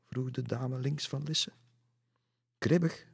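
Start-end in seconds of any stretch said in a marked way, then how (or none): 2.6-3.1 high, loud questioning repeat; puzzled echo. A narrator's reading. Lisse repeats the lady's word, puzzled echo. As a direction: echo it in surprise. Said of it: "herhaalde Lisse".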